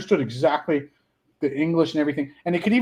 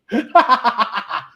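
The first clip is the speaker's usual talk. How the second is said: loud high cackle; cackling laugh; 8 semitones higher, 7 dB louder than usual. Real, not acted. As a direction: cackle out loud at your own joke. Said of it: "there's the cackler".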